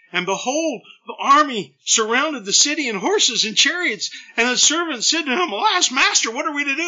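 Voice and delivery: high, loud, rushed cry of alarm; frantic, voice shooting up in terror; panicked servant. Acted. Servant crying out to his master in panic. Terrified servant cries out to Elisha.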